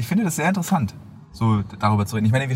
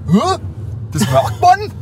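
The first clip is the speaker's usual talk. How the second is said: much higher, louder baffled question; imitated surprise; 3 dB louder, much slower than usual. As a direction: play the stunned tourist. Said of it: "Und da meinte er so".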